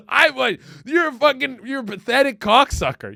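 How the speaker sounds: loud, high scornful insult; mock contempt. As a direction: berate her, over the top. Boyfriend insulting girlfriend.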